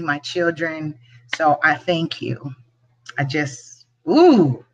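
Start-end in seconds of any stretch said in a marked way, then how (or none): 3.2-4.6 choked up. Real, not acted. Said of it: "not gonna get emotional".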